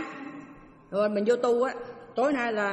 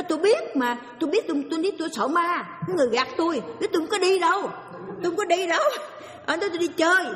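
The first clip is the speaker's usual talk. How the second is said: retold wail of a frightened girl; frightened outcry; 7 semitones higher, faster than usual. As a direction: cry out like a scared girl denying it. Girl cries she was tricked.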